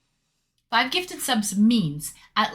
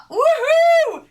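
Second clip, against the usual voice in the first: high, loud whooping cheer; excited woohoo; 7 dB louder than usual. Real, not acted. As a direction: whoop with delight. Cheering a hype moment.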